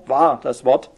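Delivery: normal talking voice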